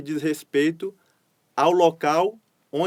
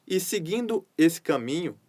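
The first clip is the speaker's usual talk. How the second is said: usual speaking voice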